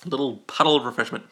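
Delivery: ordinary talk, nothing marked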